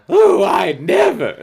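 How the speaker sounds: slow, loud, shocked huffy lady voice; prim offended lady. Retold or imitated. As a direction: play the scandalized grown-up. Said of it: "a voice for Missus Danita".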